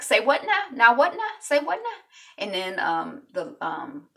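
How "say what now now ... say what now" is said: mocking.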